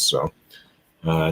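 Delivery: ordinary talk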